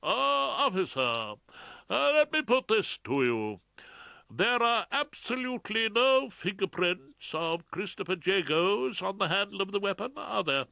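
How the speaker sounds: high, loud, pompous counsel; sudden brilliant idea. A narrator's reading. Defence counsel cross-examining the officer, pompously. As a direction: pose the question like a great discovery. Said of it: "as though a brilliant idea had just occurred".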